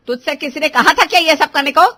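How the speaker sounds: loud, high scolding question; angry rebuke. Acted. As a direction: snap at her for meddling. Elder scolds the bride. (ऊँची आवाज़ में फटकार)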